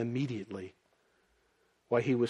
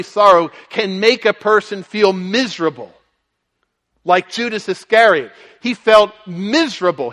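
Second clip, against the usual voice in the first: higher, louder pulpit voice pressing the point; forceful preaching; 6 semitones higher, 11 dB louder than usual. Acted. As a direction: preach it with rising urgency.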